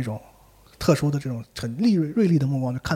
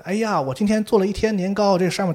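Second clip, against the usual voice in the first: coaxing fake mother; 6 semitones higher, 4 dB louder than usual. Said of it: "然后外面那人".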